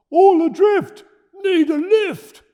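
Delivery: high, loud, slow shipwrecked roar; roaring call. Acted. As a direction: bellow for rescue across the water. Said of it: "he roared".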